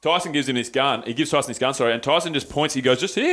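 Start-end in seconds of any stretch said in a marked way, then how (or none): 3.0-3.3 high voice. Retold or imitated. Guard voiced in story.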